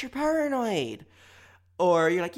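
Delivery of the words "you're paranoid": very high, drawn-out, loud; defensive accusation. Acted. Arguer denying the insult, high defensive accusation. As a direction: throw it back in a high slow voice. Acted denial turned accusation.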